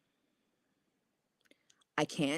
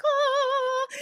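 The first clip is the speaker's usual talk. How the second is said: overwhelmed gasp; 3 dB louder than usual. Retold or imitated. Epiphany, universe talking to me.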